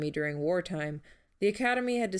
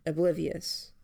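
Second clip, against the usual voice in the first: ordinary delivery, nothing marked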